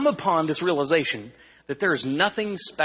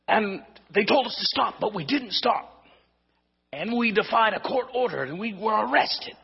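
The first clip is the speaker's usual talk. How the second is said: a high, lightly put-on Scottish missionary voice, chatty and proud; mock Scottish accent; 8 semitones higher than usual. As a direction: tell it like a Scottish visitor bragging about their adventure, voice pitched up. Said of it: "I can't even do the Scottish accent".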